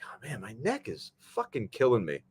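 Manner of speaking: pained groan through the words. Retold or imitated. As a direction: complain about a neck that is killing you. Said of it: "I'm like".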